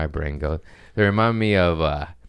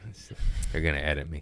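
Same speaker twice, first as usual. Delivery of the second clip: normal talking voice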